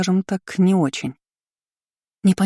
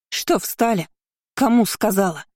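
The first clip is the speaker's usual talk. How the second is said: impatient, higher-starting rebuke; commanding; 3 semitones higher than usual. A narrator's reading. Curly-haired girl chasing frozen servants, commanding. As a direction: prod idle servants into moving. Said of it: "приказным тоном произнесла она".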